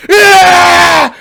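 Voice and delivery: long, high, loud scream; drawn-out demonstrated primal shout; primal yell. Shown as a demonstration. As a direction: yell the pain away. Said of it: "Take the pain away by yelling".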